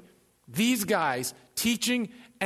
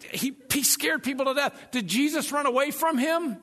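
ordinary delivery

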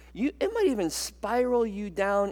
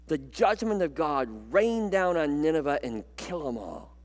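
ordinary delivery

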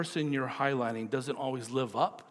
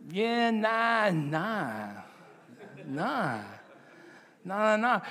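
Kiwi yeah nah; 5 semitones higher, much slower than usual. Retold or imitated. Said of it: "yeah, nah, we don't do that".

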